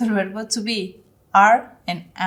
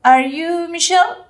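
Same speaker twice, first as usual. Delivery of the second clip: high, louder "Are you Michelle?"; demo question; 8 semitones higher, 6 dB louder, slower than usual. Shown as a demonstration. Teacher models a yes-no question, high and loud. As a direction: ask it brightly up high. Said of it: "Por ejemplo".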